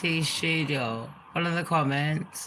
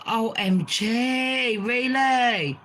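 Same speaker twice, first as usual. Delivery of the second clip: mock shock; 5 semitones higher, much slower than usual. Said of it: "It's like".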